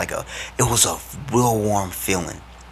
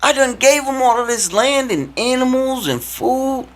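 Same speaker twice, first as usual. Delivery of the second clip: much higher, slowing, self-pitying whine; whiny emo God; 3 dB louder than usual. Acted. God complaining nobody worships him, high mock whine. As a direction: play God sulking like a hurt teenager. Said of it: "God straight up was like".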